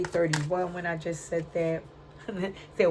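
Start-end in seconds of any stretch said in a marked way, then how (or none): none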